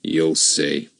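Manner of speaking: low, nasty man; menacing sneer; the kidnapper threatens Ern in a low nasty voice. Acted. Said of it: "in a nasty sort of voice".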